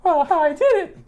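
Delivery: put-on high squeaky child voice; little kid voice. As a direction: play yourself as a proud five-year-old. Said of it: "I was like".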